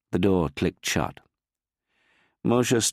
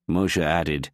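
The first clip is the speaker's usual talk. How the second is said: ordinary delivery, nothing marked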